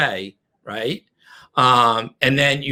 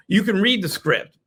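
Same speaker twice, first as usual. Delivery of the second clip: ordinary talk, nothing marked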